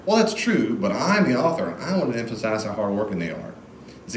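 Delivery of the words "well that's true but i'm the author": usual speaking voice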